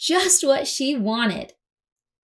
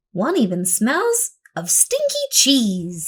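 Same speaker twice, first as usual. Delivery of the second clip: ordinary delivery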